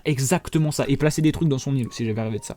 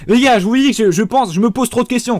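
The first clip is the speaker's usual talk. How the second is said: loud, high, worked-up rant; excited outburst; 8 semitones higher, 7 dB louder than usual. Real, not acted. Vlogger ranting to viewers, excited and loud. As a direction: blurt it out to the viewers, fired up.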